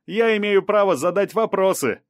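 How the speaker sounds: insistent high-pitched shout; defiant calling out. A narrator's reading. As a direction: shout back that you will not budge. Reporter shouting back at police.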